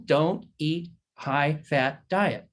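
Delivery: very slow, each word spaced; slow emphatic. Shown as a demonstration. Doctor telling patients, slow and deliberate. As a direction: spell it out slowly so it sticks. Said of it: "slow emphatic advice".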